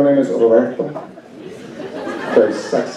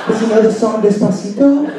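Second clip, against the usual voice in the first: high, fast, breathless string of questions; mock frantic questions; 10 semitones higher than usual. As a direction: pile on the questions in rising excitement. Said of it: "They'll be".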